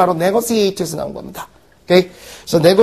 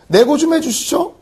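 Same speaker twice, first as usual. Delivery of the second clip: higher, coaxing customer voice; polite haggling; 8 semitones higher than usual. Retold or imitated. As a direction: coax the shop owner for a deal. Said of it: "뭐 이렇게 얘기를 해요".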